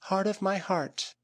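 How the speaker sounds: high, slow, tender call; soft and gentle. A narrator's reading. A woman greeting her beloved by the fire, soft and gentle. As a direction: breathe it out lovingly. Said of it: "in soft and gentle tones it spoke".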